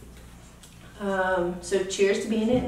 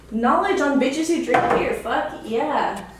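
ordinary talk

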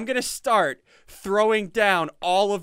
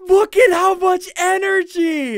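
loud, high-pitched amazement; excited shout; 8 semitones higher, 7 dB louder than usual. Real, not acted. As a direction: yell it in disbelief. Energy core fills up.

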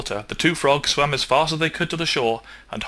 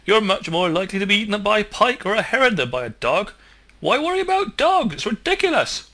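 much higher, louder mocking squeal; laughing at him; 10 semitones higher, 4 dB louder than usual. Frog ridicules his friend's fear of dogs, high laughing. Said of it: "The other frog burst out laughing".

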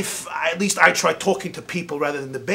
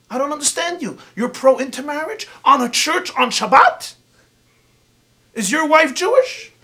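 rising higher and louder in shocked disbelief; indignant outburst; 6 semitones higher, 5 dB louder than usual. Retold or imitated. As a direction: play the offended rebbetzin confronting him. Rebbetzin outraged at the rabbi.

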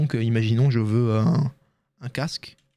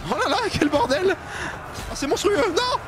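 excitation; 13 semitones higher than usual. Real, not acted. Chaos de bataille, voix aiguë.

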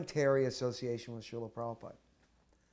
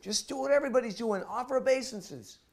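high, loud, hurried advice; the monk urges the soldier to bow, high and loud; urgent instruction; 11 semitones higher, 7 dB louder than usual. Retold or imitated.

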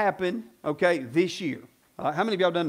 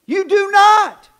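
emphatic; 11 semitones higher, 12 dB louder, much slower than usual. Real, not acted. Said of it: "preacher driving point home".

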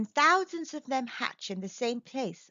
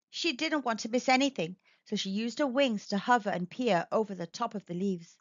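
ordinary delivery

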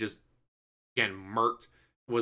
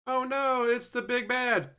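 mock alarm; 11 semitones higher than usual. Mock hero voice.

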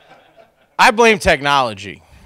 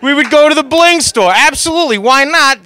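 hyped up; 9 semitones higher, 7 dB louder than usual. Acted. Exaggerated bling store bit.

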